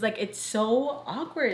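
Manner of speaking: ordinary delivery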